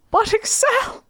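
high, far put-on whine of a fan; whiny fan. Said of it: "the Excel fans are like".

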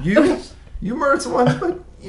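squeaky-high mock hesitation over a sentence; smitten mock judge. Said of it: "They're like".

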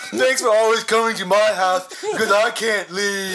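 loud hunchback voice, slowing at the end; mock misshapen bell-ringer, half laughing; Quasimodo. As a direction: play a hammed-up housebound monster. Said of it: "I love doing Quasimodo voice".

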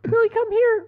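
high mock call to the dog; mock high-pitched calling out to the dog; imitated stepmom calling. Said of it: "He's like".

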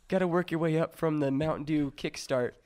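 usual speaking voice

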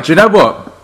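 ordinary talk, nothing marked

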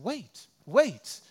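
a hint of a polite British lady; lightly imitated British woman at the crosswalk. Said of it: "sounded like a British lady".

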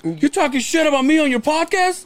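high-pitched mock accusation; Howard. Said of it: "that's how Howard talks".

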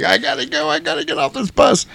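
high falsetto mock panic; panicky lady impression. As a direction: whine in a high falsetto, desperate to get out. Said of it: "the stupid lady who couldn't stay is like".

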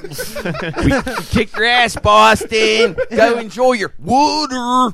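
high, slow, laughing taunt; gloating taunt. Acted. Host taunting Boston after winning the poll, high slow gloat. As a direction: rub it in at Boston.

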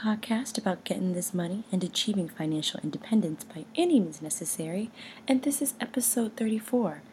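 hushed, breathy podcast opener over music; whispered intro. Acted. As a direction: whisper the show intro like a secret.

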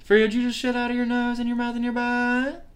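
very high, squeaky pet-talk voice; high-pitched dog scolding. Real, not acted. Owner asks the smelly dog what happened, high-pitched disbelief.

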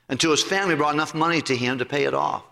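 usual speaking voice

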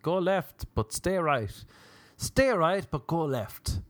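high mock navigation prompts; lilting parody satnav. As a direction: issue contradictory directions like an app.

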